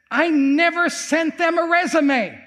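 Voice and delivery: high-pitched, acted-out astonishment; deliberate, high climax of a testimony; amazed insistence. Professor reveals his unexplained hiring to the preacher, amazed insistence.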